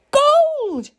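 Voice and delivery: high, loud, thrilled mother; delighted cry. A narrator's reading. Mother seeing what Jack has got, crying out in delight. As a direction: cry out high at a wonderful sight. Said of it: "cried Jack's mother when she saw".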